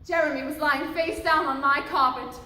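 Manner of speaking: ordinary delivery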